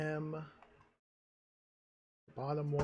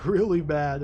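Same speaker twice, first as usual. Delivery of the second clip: much louder, laughing through the words; 11 dB louder, slower than usual. Real, not acted. Player hears the sun news, laughs loudly. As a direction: crack up at how bad it is.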